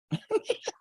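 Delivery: a quick high burst of laughter; short laugh. Real, not acted. Judge laughing at his own joke with lawyers, a short high laugh. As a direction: laugh it off, light and brief.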